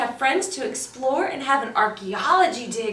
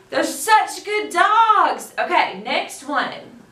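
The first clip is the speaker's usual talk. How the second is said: plain reading voice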